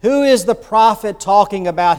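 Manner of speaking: usual speaking voice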